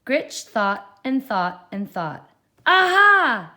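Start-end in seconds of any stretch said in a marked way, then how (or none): 2.6-3.5 very high, loud triumphant cry; excited shout. A narrator's reading. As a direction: shout it with a jump. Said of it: "she shouted with a jump".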